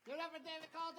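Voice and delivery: high, projected emcee call; announcer call. Real, not acted. Host to the crowd after a comic's set, rousing.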